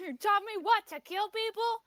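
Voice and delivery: bitter retort. Acted. Viper bitterly throwing it back at her father, high.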